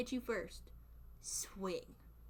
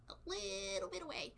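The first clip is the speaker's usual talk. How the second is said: high, soft, squeezed hedge; tentative hedging; 9 semitones higher, 4 dB quieter than usual. Real, not acted. Streamer hedges a risky claim about New Yorkers, high and tentative. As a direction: lift it high and small, hedging.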